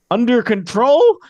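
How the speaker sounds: high, drawn-out, doubtful reassurance; unconvincing. Acted. Guard reassures panicking guests, high and unconvincing. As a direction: reassure loudly without believing it. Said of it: "and unconvincingly".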